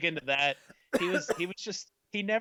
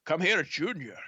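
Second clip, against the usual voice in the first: louder, higher mock-Scottish father calling; Sean Connery; 4 semitones higher, 5 dB louder than usual. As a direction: call out like a Scottish screen dad. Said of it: "remember him as Indy's father".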